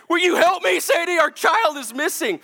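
high, loud, exasperated plea; frantic shouting. Acted. Panicked dad shouting at daughter to help search, frantic. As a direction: yell for help, at the end of your rope.

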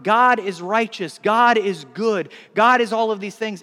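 preacher declaring God's goodness; emphatic proclamation. Real, not acted. Emphatic list of God's traits.

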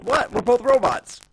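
distorted, glitchy call audio; robot voice. Real, not acted. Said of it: "now you sound normal again".

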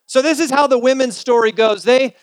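usual speaking voice

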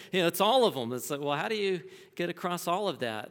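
real excited outburst after a laugh. Real, not acted. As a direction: burst out with delighted enthusiasm. Laughing burst of enthusiasm.

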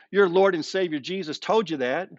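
ordinary delivery

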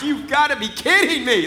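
loud, high stunned disbelief; laughing in shock; can't believe it just happened. Retold or imitated. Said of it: "I'm like".

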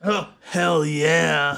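slow drawn out stoner; stoned. Acted. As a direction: stretch it out, dazed and pleased. Stoned townsfolk agreeing.

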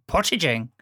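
purchasing said the Irish way, sounding like patagin; a hint of an Irish interviewer saying purchasing; light Irish accent. Irish HR man repeating his question to the candidate, lightly mimicked Irish accent. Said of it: "this strong Irish accent came out".

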